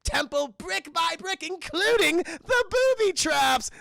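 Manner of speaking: hammed-up gleeful villain announcement; Joker. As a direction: relish each word, building to a shout. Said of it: "The Joker's saying".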